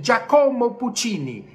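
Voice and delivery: "Giacomo Puccini" with a long stressed co, Dja-CO-mo; American mispronunciation. Retold or imitated. Teacher imitates Americans naming Puccini, mispronunciation. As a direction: lean on the middle of "Giacomo". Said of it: "eles, eles dizem".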